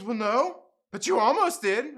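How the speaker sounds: high, loud, defensive comeback; put-on aggrieved tone; mock huffy protest. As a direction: imitate a stranger arguing back. Acts out the lady arguing back.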